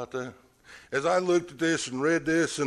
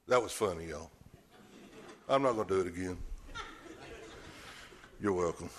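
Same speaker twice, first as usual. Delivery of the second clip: ordinary delivery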